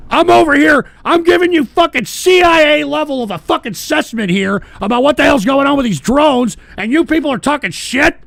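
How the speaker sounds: shouting down the trolls in real anger; angry rant. Real, not acted. Streamer yelling at chat that mocks his drone assessment, angry.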